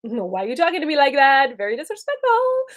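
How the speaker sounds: hammed-up disapproving parent; irritated mom. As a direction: push back on a rude kid in mock outrage. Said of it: "It creates a frustration and irritation".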